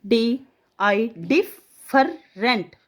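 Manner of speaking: डिफरेंट with a long doubled ff and held rr, very slow; spelling teacher exaggerating the ff; stretched double f. Shown as a demonstration. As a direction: drag out डिफरेंट to show the double f. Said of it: "ध्यान से बोलते time".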